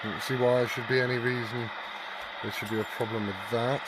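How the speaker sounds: ordinary talk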